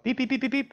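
high, sharp electronic beeps; imitating a pager. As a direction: mimic the ambulance pager beeping. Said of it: "ambulance pager go off".

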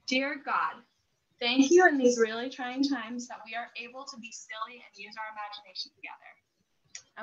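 calm sincere prayer voice. Real, not acted. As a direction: pray it gently with the family. Leads short prayer.

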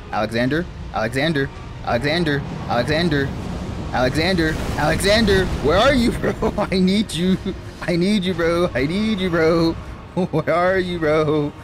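repeated calls building to a high, pleading yell; playful desperate wail for a missing buddy; calling out desperately. Real, not acted. Calling out for missing game companion.

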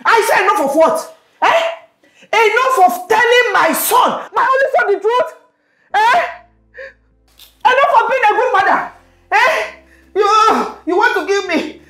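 very high, loud, outraged mother; furious shrieking rant. Acted. Mother screaming at son over his secret, outraged. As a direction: throw each question back at him in outrage.

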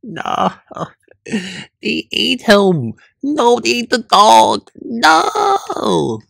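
usual speaking voice

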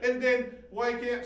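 ordinary delivery

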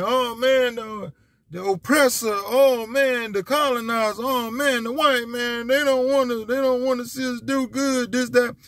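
high whiny mock complaint, playing ignorant; dumb voice. Mocking ignorant people who blame the oppressor, in a high dumb voice. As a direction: whine like a clueless guy blaming everyone. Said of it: "That's my dumb, dumb voice".

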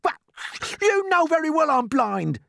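high, loud offended retort; snapping. A narrator's reading. Blind earthworm answers James's careless remark, snapping. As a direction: snap back as a touchy earthworm. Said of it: "snapped the earthworm".

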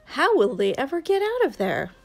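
plain reading voice

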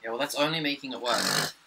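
ordinary talk, nothing marked

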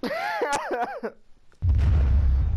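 very high, loud cackle; loud laugh. Real, not acted. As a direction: burst into a squealing laugh. Laughs after dying.

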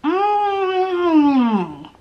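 pleased tasting hum; savoring hum. Real, not acted.

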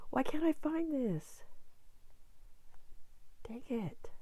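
high, slow whispered frustration; frustrated whisper. Real, not acted. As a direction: whisper your annoyance. Frustrated while searching.

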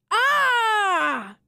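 high, loud glad cry of greeting; calling out. Acted. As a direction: call out across the room in delight. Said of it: "he called out to me".

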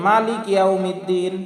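"মালিক ইয়াউমিদ্দিন" unstretched, cut at K; common misreading. Shown as a demonstration. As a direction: read "মালিক" ending on K, no final I. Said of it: "এটা আমাদের সম্পূর্ণ ভুল".

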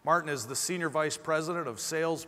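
ordinary talk, nothing marked

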